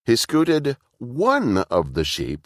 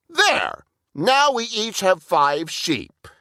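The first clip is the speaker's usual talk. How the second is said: high and slow, satisfied; high, pleased Burple; pleased little brother; 11 semitones higher, slower than usual. A narrator's reading. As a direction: announce the fix like a smug kid. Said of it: "Burple evens the piles".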